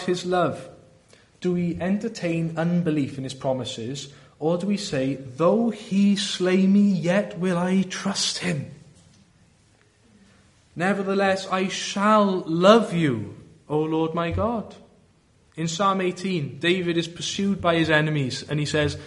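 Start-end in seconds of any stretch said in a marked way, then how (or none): 5.2-14.7 devout, slow. Acted. Quoting Scripture with devotion.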